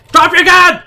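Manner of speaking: loud, high yell of drop your gun; shouted command. Real, not acted. Yelling at the armed guard to drop the gun, loud shout. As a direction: bark it at the guard at full volume.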